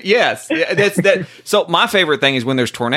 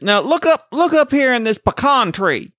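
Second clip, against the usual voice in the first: higher-pitched country farmer, lightly imitated; lightly voiced farmer; 10 semitones higher than usual. Farmer to firefighters about a find, lightly imitated. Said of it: "this farmer waves us down".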